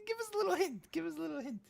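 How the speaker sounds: tiny, very high wheedling voice; pleading squeak. Pretend. Streamer begging Richard for a little hint, squeaky plea. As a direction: beg in a small, high squeal.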